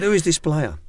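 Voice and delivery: a hammed-up Israeli accent through a laugh; Israeli accent again. Ex-coach repeating a teammate's question in a mild Israeli accent. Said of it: "Trying to do an Israeli accent".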